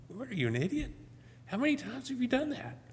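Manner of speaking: high-pitched mock exasperation; frustrated self-scolding. Man berating himself in the rearview mirror, frustrated. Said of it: "I get frustrated and say".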